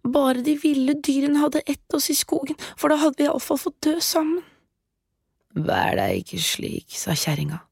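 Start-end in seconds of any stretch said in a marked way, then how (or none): 0.1-4.5 put-on high, fast lamenting girl voice; wailing girl. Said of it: "jamret og gråt". 5.6-7.0 low, slow scornful witch. Acted. Witch dismissing Grete.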